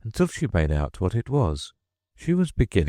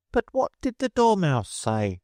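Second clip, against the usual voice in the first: high juror voice; 13 semitones higher than usual. Acted. Juror asking.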